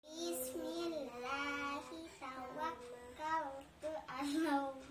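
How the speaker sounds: slow chanted recitation; Quran recitation. Acted. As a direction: chant the verses slowly and evenly. Closing prayer recitation.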